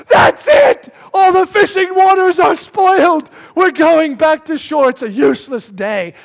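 high, loud yell easing off toward the end; hammed-up outraged shouting; fed-up fishermen. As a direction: play exasperated fishermen calling off the trip. Acts fishermen giving up in outrage.

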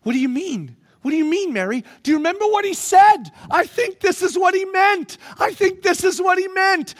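women realizing the truth, shouting excitedly. Acted. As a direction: build from question to joyful shout. Women realize Jesus is risen.

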